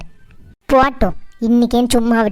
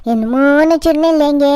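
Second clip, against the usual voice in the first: high, put-on innocent whine; mocking daughter-in-law; 4 semitones higher than usual. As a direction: mimic her fake innocence, higher. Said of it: "அப்படின்னு நடிப்பு போட்டுருவா".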